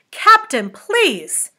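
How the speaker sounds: high, loud, sharp appeal; sharply. A narrator's reading. First officer begging the captain to stop, sharply. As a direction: plead sharply, starting high and dropping. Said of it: "said sharply".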